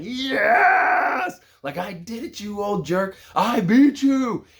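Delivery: high, loud victory shout at his dad; triumphant yell. Retold or imitated. Boy gloats to his dad after the win, shouting high. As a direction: crow it in his face, thrilled.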